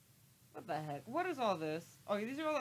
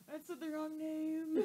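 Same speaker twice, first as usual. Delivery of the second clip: high, slow drawn-out whine; hammed-up, pitched-up correction; mock complaint; 8 semitones higher, 4 dB louder, much slower than usual. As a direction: stretch it out as a comic protest. Retelling comic correction of friend.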